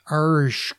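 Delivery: drawn-out wordless mumble with hushing; garbled speech noise. A narrator's reading. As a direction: imitate words that can't be made out. Girlfriend speaks, listener cannot hear her.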